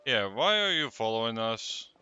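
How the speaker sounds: ordinary talk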